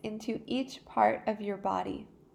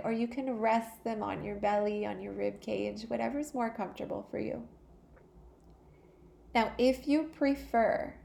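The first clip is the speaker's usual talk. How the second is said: normal talking voice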